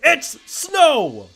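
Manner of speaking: loud, high yell of protest; a kid yells at the teacher in protest; indignant shout. Retold or imitated.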